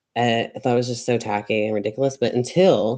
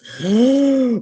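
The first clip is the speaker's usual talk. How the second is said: loud, high intake of breath; amazed gasp; 13 semitones higher, 7 dB louder than usual. Real, not acted. Reacting to the project working out, amazed gasp.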